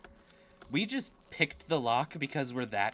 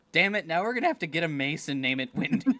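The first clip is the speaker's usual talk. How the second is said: laughing; 4 semitones higher, 6 dB louder, faster than usual. Real, not acted. Laughing while joking.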